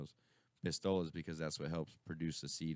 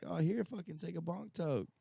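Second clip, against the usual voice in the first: sly offer; 13 semitones higher than usual. Retold or imitated. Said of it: "And I was like".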